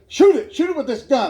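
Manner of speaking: mock frustrated urging, pitched slightly up. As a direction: urge him on, losing patience. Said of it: "He's getting frustrated".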